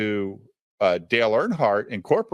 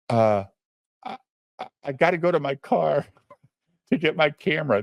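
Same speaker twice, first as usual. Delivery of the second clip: higher, halting dad with a laugh creeping in; mock nervous; 5 semitones higher, faster than usual. Nervous dad admits he forgot the camera, nervously stammering. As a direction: imitate a flustered man tripping on his words. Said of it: "the most nervous thirty-year-old man".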